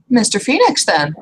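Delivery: high, gasping surprised greeting; gasp, then greet him brightly; gasping troll woman. Acted.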